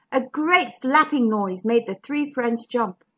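usual speaking voice

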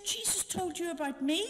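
imitating an astonished doctor, pitched high; retold high-pitched excited question; excited disbelief. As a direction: ask it in amazed, rising excitement. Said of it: "And she got all excited".

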